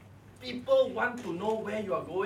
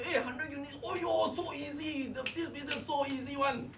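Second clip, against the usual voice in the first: breezy excited boast; 6 semitones higher, 6 dB quieter than usual. Retold or imitated. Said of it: "I say".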